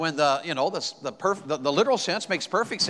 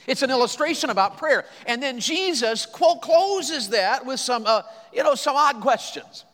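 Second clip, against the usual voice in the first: higher, louder pulpit delivery building up; rising preaching; 7 semitones higher, 4 dB louder than usual. Acted. Preacher lifting the congregation with Jesus's words, preaching. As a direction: climb into the sermon's first peak.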